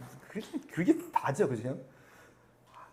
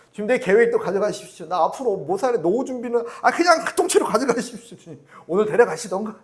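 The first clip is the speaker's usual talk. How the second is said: high, loud pleading of a weary believer; exasperated plea; 5 semitones higher, 8 dB louder than usual. Pretend. Believer handing life plans to God, loud exasperated pleading. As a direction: throw everything at God half-jokingly.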